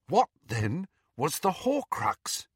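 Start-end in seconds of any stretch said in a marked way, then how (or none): none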